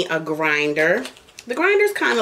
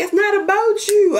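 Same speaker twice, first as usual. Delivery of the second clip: raised mock retort; imitating husband; 12 semitones higher, 4 dB louder than usual. Wife mimicking Daddy brushing her off, high mock voice. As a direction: toss off his dismissive reply. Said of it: "He be like".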